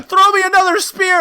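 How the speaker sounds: high, loud comic call; hammed-up demand from a wounded man; mock frantic yell. Imitating the speared character calling out.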